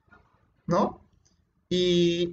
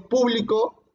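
"público" stressed on PÚ; stress on first syllable; 5 semitones higher, slower than usual. Shown as a demonstration. Teacher showing how accent changes words, stress on PÚ. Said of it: "no es lo mismo decir".

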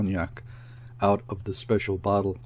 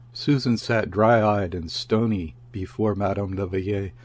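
ordinary delivery, nothing marked

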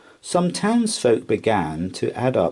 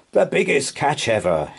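smug, higher-pitched gloating; smirking; 6 semitones higher than usual. A narrator's reading. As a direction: gloat to yourself over the prize. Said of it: "he thought with a smirk".

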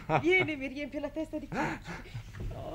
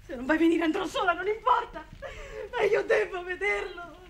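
louder, higher, the last line slowed; tearful, stubborn outburst; defiant insistence; 5 semitones higher, 5 dB louder than usual. Acted. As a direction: insist you'll go alone, voice cracking.

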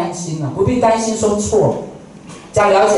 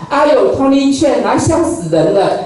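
put-on higher, teasing TV co-host laughing at his accent; 罗美美 mocking; 4 semitones higher than usual. TV co-host teases the English teacher, mock sneer pitched higher. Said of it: "她在节目里面最喜欢讲这种话".

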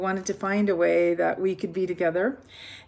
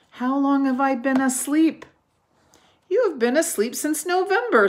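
higher, louder character voices; lively characters; 7 semitones higher, 6 dB louder, faster than usual. A narrator's reading. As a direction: play the two friends. Dialogue of Toad and Frog.

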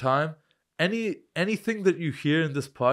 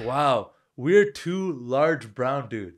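slow realization; much slower than usual. Retold or imitated. Mock realization.

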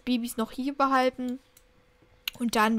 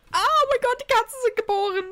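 much higher and louder squeal of joy; thrilled squeal; 11 semitones higher, 6 dB louder than usual. Real, not acted. To the viewers as the kittens arrive, thrilled squeal. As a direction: shriek with delight at the newborns. Kittens are born in game.

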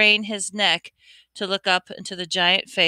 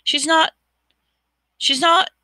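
high, frantic protest; frantic denial; 11 semitones higher, 5 dB louder than usual. Acted. Harry refusing to believe Ginny is dying, frantic.

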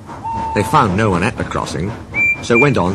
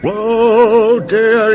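high, loud cry of alarm; alarmed exclamation; 5 dB louder than usual. A narrator's reading. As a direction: exclaim in fright, pitched way up. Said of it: "exclaimed Thomas".

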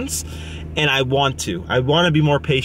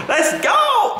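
excited shout. Real, not acted. Excited arrival cheer.

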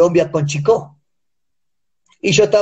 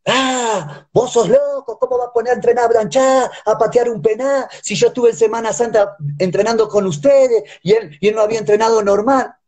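higher, half-mocking scolding president; club president scolding; 5 semitones higher than usual. Coach retelling how the club president scolded him, higher mock scold. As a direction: imitate the president chewing him out. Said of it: "y me dice".

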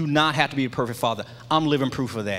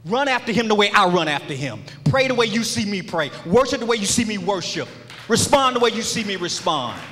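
raised-pitch, rhythmic litany, each line falling at the end; impassioned preaching; 5 semitones higher, 3 dB louder than usual. Real, not acted. Father charging his son to follow his example, impassioned preaching. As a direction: preach it in driving parallel phrases.

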